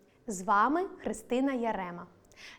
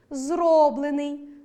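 зроблений with loud, stretched РО; stress on the root; 3 semitones higher, 6 dB louder, much slower than usual. Shown as a demonstration. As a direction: slowly punch РО in зроблений. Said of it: "наголос на корені". (наголос на корені: зрОблений)

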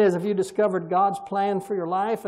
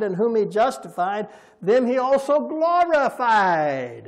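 raised, ringing preacher's reading voice; forceful scripture reading; 4 semitones higher, 7 dB louder than usual. Acted. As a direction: proclaim the verses to the congregation. Reads Romans passage aloud forcefully.

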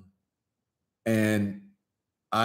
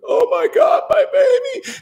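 much higher, loud cry with a laugh; imitating emotional mom; 6 dB louder than usual. Son imitating his mother at the aisle, high loud cry. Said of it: "She goes".

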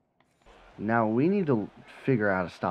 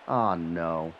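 ordinary talk

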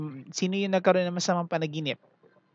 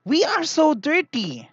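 much higher, slow, lightly voiced toy truck calling out; shouting small truck; 10 semitones higher, 4 dB louder, slower than usual. Storyteller voicing the small truck for the kids, a mild mock shout. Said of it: "shouted the small truck".

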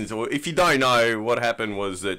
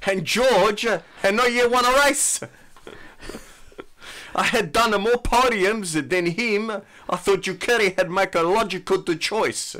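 put-on Italian accent; 8 semitones higher than usual. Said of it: "Nick, you can pull this one off".

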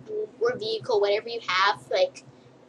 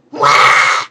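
fake wail; 10 semitones lower, 10 dB louder than usual. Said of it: "and then be like".

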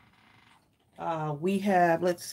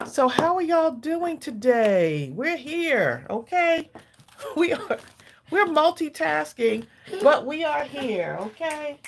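excited; 9 semitones higher than usual. Real, not acted. Cheerful greeting to stream viewers.

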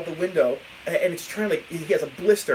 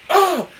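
high, loud "oh" of sudden pain; mock pained yelp; 13 semitones higher, 9 dB louder than usual. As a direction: yelp like you've just been burnt. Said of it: "I hear him go".

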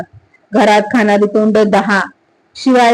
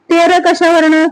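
startled question, pitched much higher; surprised; 7 semitones higher than usual. Acted. Sata hears the culprit's name from Namja, surprised and disbelieving.

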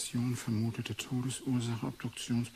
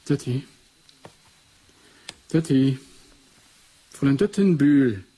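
louder and louder calls across the room; calling out; 3 semitones higher, 12 dB louder than usual. Acted. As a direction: call for her, getting louder each time. Ruft nach der Assistentin. (laut rufend, immer lauter)

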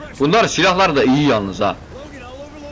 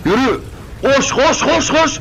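much higher, excited cries of run; excited urging; 10 semitones higher, slower than usual. Real, not acted. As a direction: urge him on, getting more worked up. Urging his character to run.

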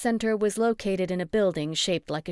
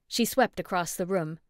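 plain reading voice

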